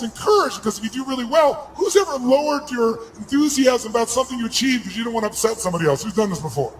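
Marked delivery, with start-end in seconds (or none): none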